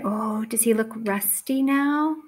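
usual speaking voice

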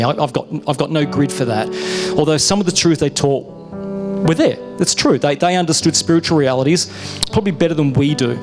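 usual speaking voice

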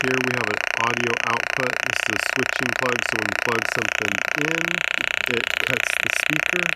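loud, boxy radio speaker sound; crackly walkie-talkie loudspeaker voice; through a CB handset. Real, not acted. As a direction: talk into the handset so it blares from the speaker.

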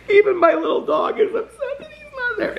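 high, wailing fake sobs; mock crying. Jealous of friends at BravoCon, hammed-up wailing sobs. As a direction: ham up a jealous meltdown. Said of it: "that whole first part was all a joke".

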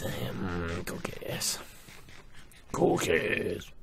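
breathy hungry whisper of cookies; hushed greedy whisper. Real, not acted. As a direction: murmur the word like a craving. Craving cookies, whispered to himself.

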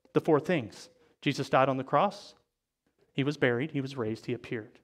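fading to a hush. Real, not acted. Gospel points fading into hush.

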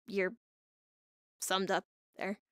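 ordinary talk, nothing marked

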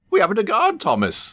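high, loud call; high urgent coach call. Acted. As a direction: shout ahead to the engine. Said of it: "called Annie".

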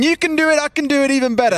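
voiced player's boast. Caster voicing the players' rivalry, lightly acted boast. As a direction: speak the players' thoughts as a boast.

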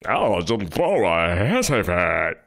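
slow, put-on sneer; mocking repeat. Mocking the host's words, slow sneer. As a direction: drag out his phrase.

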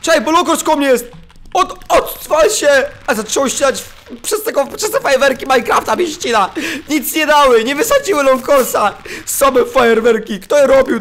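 high and loud, rattled and hurried; panicked excitement. Real, not acted. Gamer fleeing the mob while his game lags. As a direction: yell in alarm as the mob closes in.

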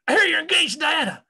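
loud, high imitated holler; hammed-up old colleague hollering; calling out. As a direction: shout it across a parking lot. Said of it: "And he said".

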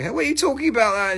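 high-pitched mock disbelief; retold indignant surprise. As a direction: imitate yourself caught off guard. Retells own reaction to sister.

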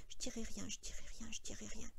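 whispered repetition under the breath; soft murmur to himself. A narrator's reading. Lenny murmuring to himself to memorise, whispered. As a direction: murmur it over, barely voiced. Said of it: "Lenny murmura en lui-même doucement".

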